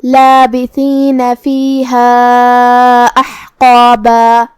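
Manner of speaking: slow, drawn-out tajweed chant ending on a long alif; slow Quran recitation. Shown as a demonstration. Teacher recites the verses for students, slow chant. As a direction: chant slowly, holding each long vowel. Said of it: "ہم اس پہ رکیں گے تو مد الف سے رکیں گے".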